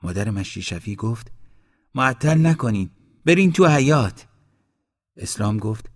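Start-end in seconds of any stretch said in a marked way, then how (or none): 1.9-4.2 raised voice, climbing higher; urgent order. A narrator's reading. Groom's mother sends dawdlers into the yard, loud and urgent. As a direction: hurry them along loudly.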